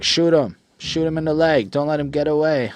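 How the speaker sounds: retold shouting of orders; yelled orders. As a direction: shout the order at her friend holding the gun. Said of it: "she's like".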